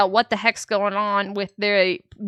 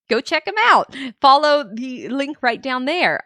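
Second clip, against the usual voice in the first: usual speaking voice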